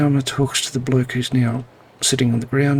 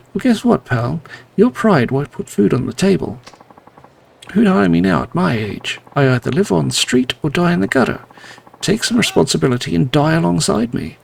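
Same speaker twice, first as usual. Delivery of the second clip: 1 bitter rage; 3 semitones higher, 4 dB louder, faster than usual. Acted. Ex-employee threatens boss's son.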